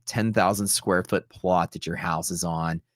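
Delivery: ordinary delivery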